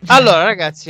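ordinary delivery

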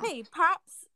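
put-on Brooklyn wise guy accent, lightly done; a hint of a New York mafia accent. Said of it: "he keeps calling Donald Pops".

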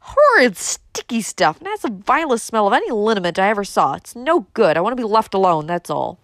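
loud, disgusted, emphatic rant; exasperated outburst. A narrator's reading. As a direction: let pent-up irritation burst out. Rebellious outburst at aghast cousin.

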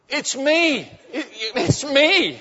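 high-pitched comic cry; hammed-up plea to stop an attack; panicked pleading. Husband pleads as wife attacks him.

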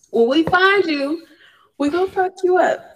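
loud mock tough-guy threat through laughter; hammed-up threat. Mock threat after crying joke.

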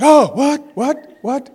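high, loud jolt awake; imitated startle. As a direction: mimic mom jerking awake in confusion.

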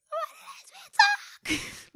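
mock tearful whine of the sister; crying daughter imitation. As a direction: imitate a sobbing little girl's wail. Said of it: "That's exactly how she sounds".

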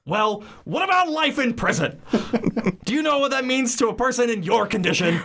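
high, frantic husband voice; panicked Ernest. Acted. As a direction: squeal it out in horror. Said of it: "read for Ernest".

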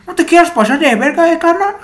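high, loud, hammed-up Mexico City slang voice; mock chilango accent, pitched well up and loud; chilango impression. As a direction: play a tough chilango warning someone off. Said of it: "mi mejor imitación de un chilango".

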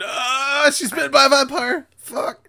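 high, loud panicked yell; mock panic shouting the news. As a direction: run out shouting in alarm. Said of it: "he runs out going".

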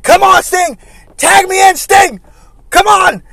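loud high mock yell from the apron; yelling for the tag. Flair on the apron begs Sting for a tag, shouting. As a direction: call for the tag, arms up.

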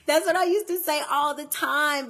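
laughing loud through the words; bursting into laughter as she recalls it. Real, not acted. Reminiscing with the audience, laughing out loud. Laughing at her own story.

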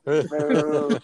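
loud, drawn-out boo. Real, not acted. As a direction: heckle it loudly. Joins in booing Superman.

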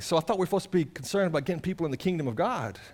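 usual speaking voice

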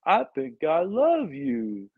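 slow, drawn-out mock speech-impediment voice; Simple Jack impression. Buddy mocks a stroke survivor with a movie voice, slow mock impediment. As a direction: ham up the movie character, slowly. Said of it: "the dude with the speech impediment".

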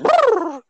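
shrill mock trill with the lips; high trilling brrr. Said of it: "she go be like".

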